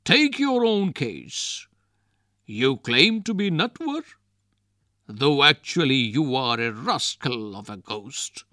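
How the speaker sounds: high, loud bragging; boastful. Acted. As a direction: show off his strength. Wrestler boasting to ghost.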